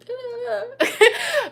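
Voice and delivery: a high knowing giggle; knowing laugh. Real, not acted. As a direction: laugh it off, a little cheeky. Laughing about platform limits.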